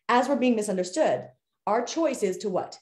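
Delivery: ordinary talk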